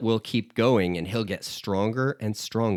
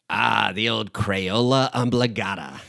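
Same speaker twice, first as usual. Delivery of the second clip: Moe. Retold or imitated. Moe to Homer before putting the crayon back, bartender impression. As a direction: put on a bartender's character voice, savouring each word. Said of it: "and Moe says".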